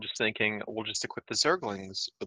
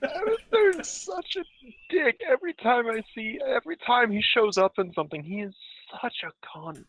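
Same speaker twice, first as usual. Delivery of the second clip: incredulous rant; 11 semitones higher than usual. Real, not acted. Ranting about Abathur.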